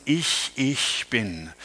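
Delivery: "ich, ich bin" drawn out slowly, first "ich" stressed and repeated; doubled stressed I, slow. Shown as a demonstration. Preacher recalling the seven sayings, slow "ich, ich bin" with the doubled ich stressed. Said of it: "dieses doppelte, betonte Ich".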